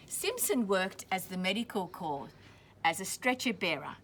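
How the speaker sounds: clear, steady storybook reading; reading to children. A narrator's reading. Teacher reading a war story to children, steady storybook voice. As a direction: read it out for the class. Reading picture book aloud to class.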